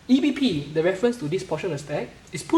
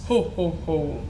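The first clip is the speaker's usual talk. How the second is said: Santa laugh; slower than usual. Acted. Santa introduced in Christmas story.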